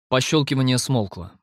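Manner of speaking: ordinary delivery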